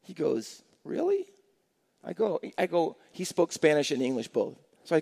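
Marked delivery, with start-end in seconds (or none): 0.8-1.3 high, soft incredulous query; small, high-pitched retold surprise; surprised question. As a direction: imitate quiet disbelief, pitched way up. Retold man reacts to challenge.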